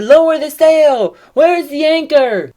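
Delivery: high, loud, drawn-out shout. Acted. Disciples to each other in storm, urgent shouting. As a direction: call out urgently over a storm. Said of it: "They shouted".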